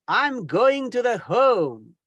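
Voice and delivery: slow, drawn-out I'm going to the home; slow wrong example. Shown as a demonstration. Teacher slowly mocking a learner's wrong phrase. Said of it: "instead of".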